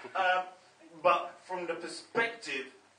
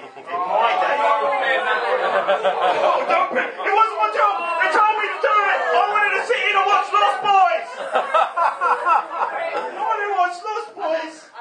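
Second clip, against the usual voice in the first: whiny protest; 13 dB louder, slower than usual. Pretend. Mock protest about Twilight.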